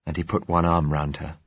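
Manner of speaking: plain reading voice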